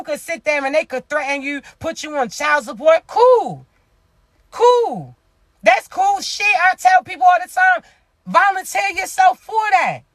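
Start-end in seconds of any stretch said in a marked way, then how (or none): 3.1-6.5 defiant high cool. Real, not acted. Defiant dismissal of threats. 6.7-10.0 raised preaching shout. Real, not acted.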